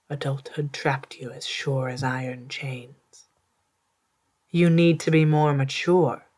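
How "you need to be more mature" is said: put-on loud, scolding lecture to a grown child. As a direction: scold them for not growing up. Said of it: "they said".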